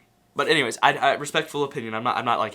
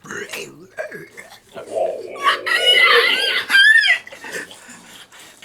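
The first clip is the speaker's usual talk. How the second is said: zombie screech; 3 dB louder than usual. Pretend. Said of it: "For all of our undead fans out there".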